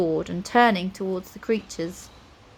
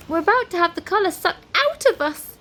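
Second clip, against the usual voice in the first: high, loud cry of alarm; 9 semitones higher, 5 dB louder than usual. A narrator's reading. Nine sees the indigo pulled away, alarmed. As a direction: warn them in rising panic.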